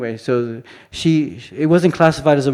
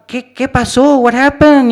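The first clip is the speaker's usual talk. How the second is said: put-on much higher, louder Mexican accent; hammed-up Mexican tourist asking; 11 semitones higher, 8 dB louder, slower than usual. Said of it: "acted like I was a tourist from Mexico".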